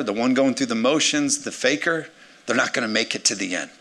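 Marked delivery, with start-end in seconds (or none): none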